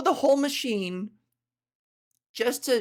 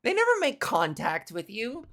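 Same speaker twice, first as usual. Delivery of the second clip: usual speaking voice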